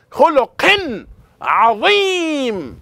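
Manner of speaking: high, loud burst, last word stretched long; excited recitation. Shown as a demonstration. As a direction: proclaim it with excitement, drawing out عظيم. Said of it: "Did you hear the excitement in my voice?".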